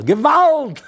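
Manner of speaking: very high, loud Yiddish cry; full yell. Acted. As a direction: yell it like a woman in labor. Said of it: "he hears a yell".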